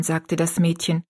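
ordinary delivery, nothing marked

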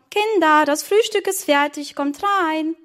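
put-on mother calling the children to breakfast. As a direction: call the kids in from the garden. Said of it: "rief die Mama".

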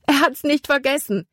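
high, joyful cry; Libby calling out to Caleb in relief; relieved call. Acted. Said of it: "rief sie".

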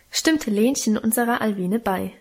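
usual speaking voice

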